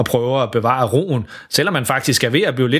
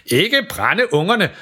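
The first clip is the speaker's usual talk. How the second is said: burnt-tongue pleading; 6 semitones higher, slower than usual. A narrator's reading. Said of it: "forsøger far at sige".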